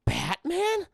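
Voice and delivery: high-pitched disbelieving mock question; incredulous. Streamer voicing a stunned man on the phone, incredulous. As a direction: squeak the name in disbelief. Acted stunned reaction to Batman's call.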